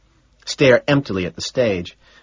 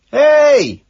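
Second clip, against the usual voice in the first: high, full-voiced shout; 9 dB louder than usual. A narrator's reading. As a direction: call out at the top of your voice. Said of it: "I shout".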